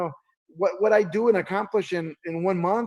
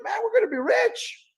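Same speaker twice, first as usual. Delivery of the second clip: high, rising thrill about getting rich; giddy excitement. Retold or imitated. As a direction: gush like a dreamer. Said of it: "She goes like".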